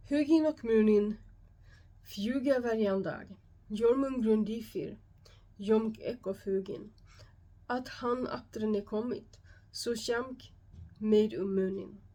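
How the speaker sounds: put-on Old Norse read in a heavy Swedish accent. Shown as a demonstration. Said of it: "it's gonna be with a heavy Swedish accent".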